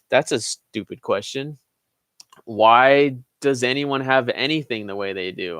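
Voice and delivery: normal talking voice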